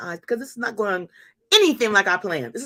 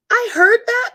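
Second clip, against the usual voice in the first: shocked; 12 semitones higher, 9 dB louder than usual. Real, not acted. Shocked at daughter's news.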